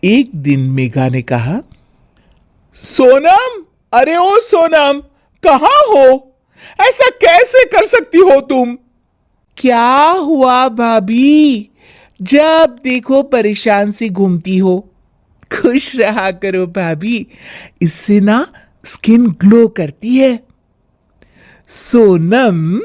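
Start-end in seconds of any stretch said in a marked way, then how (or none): 2.7-8.8 high, loud summoning call. Acted. Bhabhi calling loudly for Sonam, indignant. 9.6-20.4 cheeky sister-in-law. Acted. Sonam teasing her bhabhi, cheeky.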